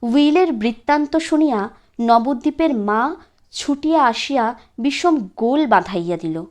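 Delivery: ordinary delivery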